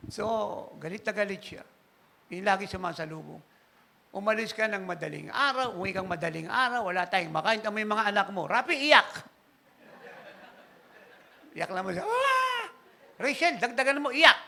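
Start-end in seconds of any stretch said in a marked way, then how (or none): 4.1-9.1 mock angry wife calling the children; scolding wife. Wife scolding, orders kids cry. 12.1-14.4 high louder mock wail and prodding; hammed-up wife making kids wail. Voicing wife prodding kids to cry.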